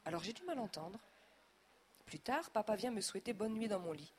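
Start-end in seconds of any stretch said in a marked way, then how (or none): none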